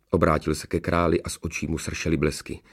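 usual speaking voice

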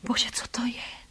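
soft, frightened whisper; horrified whisper. A narrator's reading. Woman hears a mysterious voice at night, horrified whisper. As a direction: whisper it, aghast. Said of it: "zašeptala jsem zděšeně".

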